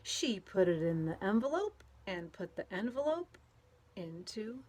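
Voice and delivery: lively storybook read-aloud with playful sound words; reading to children. A narrator's reading. Teacher reading a picture book to children, lively and playful. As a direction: read it out to a group of kids, bouncing along.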